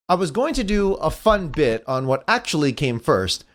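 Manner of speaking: normal talking voice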